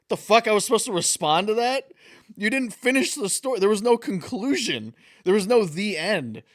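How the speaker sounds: loud, high-pitched mock outrage; retold exasperation, hammed up and loud; exasperated retort. Acting out exasperated reply to friend.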